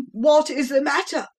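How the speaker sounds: high, loud frantic father; anxious shout. Acted. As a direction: shout in alarm for the child. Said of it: "shouted the agonized father".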